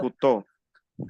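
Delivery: kuto slow, final o round and clear. Shown as a demonstration. Teacher showing the student how she says kuto, slow. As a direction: say kuto slowly, stress on to. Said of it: "Yo digo kuto".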